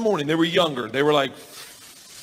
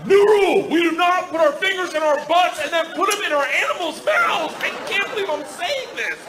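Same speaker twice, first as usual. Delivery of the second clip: high, strained scolding; appalled dad; 13 semitones higher than usual. Retold or imitated. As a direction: lay down the law in appalled disbelief. Appalled father lays down rule.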